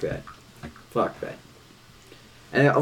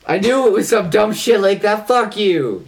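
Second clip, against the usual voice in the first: mock outrage; 8 semitones higher, 5 dB louder, slower than usual. Real, not acted.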